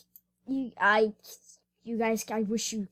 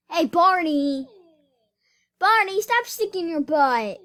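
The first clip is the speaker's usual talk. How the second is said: loud, raised call to the dog; 7 semitones higher, 10 dB louder than usual. Real, not acted. Vlogger calling out to her dog Barney, loud and raised. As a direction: call out across the room to Barney.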